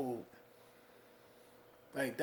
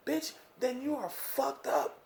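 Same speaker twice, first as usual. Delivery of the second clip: ordinary talk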